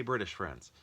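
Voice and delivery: usual speaking voice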